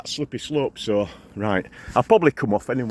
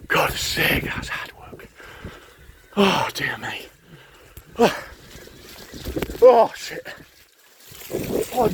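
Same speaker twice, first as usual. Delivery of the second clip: whispered, out of breath from the climb; breathless whisper; 6 semitones higher, slower than usual. Real, not acted. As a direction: gasp it out under your breath. Gasping up a hard climb.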